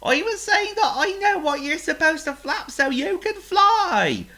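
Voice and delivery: a pig eager to explain; thrilled pig voice; excited pig. Acted. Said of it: "called Oink in great excitement".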